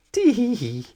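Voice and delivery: high tee hee hee giggle. Acted. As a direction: giggle with delight. He laughed out loud.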